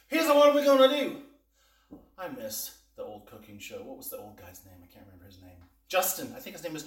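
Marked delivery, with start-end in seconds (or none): 0.1-1.2 high, loud showman announcement; TV chef announcer. Shown as a demonstration. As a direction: belt it like a TV cooking host. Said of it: "So here's what we're gonna do".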